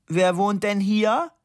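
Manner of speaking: slow, high, loud asking; drawn-out question, pitched right up; loud showy question. A narrator's reading. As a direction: ask it loudly for all to hear. Mock-deaf friend asks loudly on bus.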